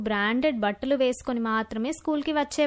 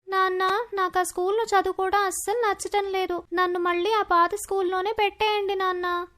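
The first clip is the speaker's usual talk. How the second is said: put-on higher-pitched girl voice, mildly pleading; small, raised daughter voice; upset young daughter; 4 semitones higher than usual. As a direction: plead with dad like a hurt schoolgirl. Hurt daughter pleads with father.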